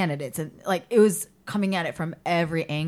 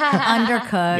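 fussy reviewer; 4 semitones higher, 5 dB louder, much slower than usual. Acted. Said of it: "That's how I read Yelp reviews".

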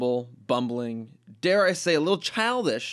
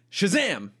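higher, grand shout; magic word shout; 6 semitones higher than usual. Acted.

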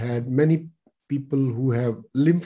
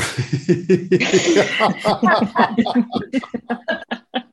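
long hearty laugh out, higher; big laugh; 8 semitones higher than usual. Shown as a demonstration. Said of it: "and laugh it out".

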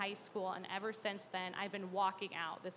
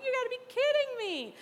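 much higher, louder indignant outburst; angry disbelief; 7 dB louder than usual. Retold or imitated. Teen hearing the book is about Hosea, angry at God and indignant. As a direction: throw it out in furious disbelief. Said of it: "And I was just so angry at God".

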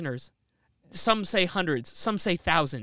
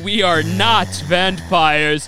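loud, slow, theatrical vampire; over-reassure the guest grandly; hammed-up vampire host; 6 dB louder, slower than usual. Vampire host assures stranded guest they aren't vampires.

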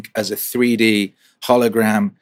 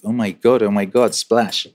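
normal talking voice

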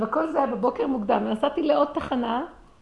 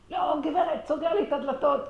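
mock angry bus driver, pitched higher; 6 semitones higher than usual. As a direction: snap it back like an irritated driver. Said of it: "כועס".

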